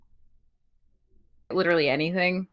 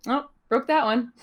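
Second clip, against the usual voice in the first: higher, sweetly innocent Canadian lilt; sweet little Canadian voice; 6 semitones higher, 4 dB louder than usual. Retold or imitated. Quoting Kevin Owens breaking chairs in a sweet Canadian voice. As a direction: play it cute and apologetic, higher up. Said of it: "in the sweetest little Canadian voice".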